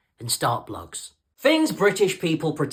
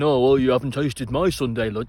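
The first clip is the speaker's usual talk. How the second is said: lower-pitched imitation of an objector; put-on low voice of someone objecting; mock objector; 6 semitones lower than usual. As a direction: mimic a protesting home cook, pitched down. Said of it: "I know what you're saying".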